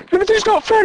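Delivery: put-on very high, squeaky child whine; imitating a child character's high whine; whiny child voice. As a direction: whine it like a sulky kid. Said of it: "He talks like this".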